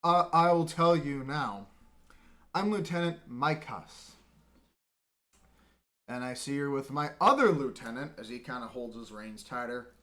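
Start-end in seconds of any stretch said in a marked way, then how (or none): 0.0-8.2 loud, stern lieutenant; annoyed. Acted. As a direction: lay down who's in charge. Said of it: "now looks really annoyed and goes".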